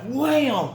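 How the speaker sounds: high-pitched "wham" sound effect; sudden high mock impact noise; imitated impact. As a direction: exclaim the blow up high.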